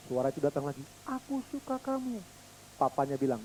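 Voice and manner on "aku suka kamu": very soft, far off; faint echo. Pretend. Echo answering the boy's fondness, very faint. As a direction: whisper it back like an echo.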